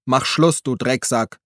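shouted insult. A narrator's reading. Harry screams at the tank driver, loud and defiant. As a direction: hurl it at the gunner. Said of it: "Harry schrie".

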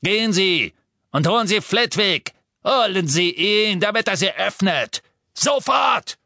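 high, loud shouted command; raging, imperious shout; furious order. A narrator's reading. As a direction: bark orders, climbing to a yell. Furious Amicus orders professor about.

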